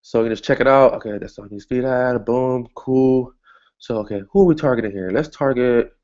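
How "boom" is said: usual speaking voice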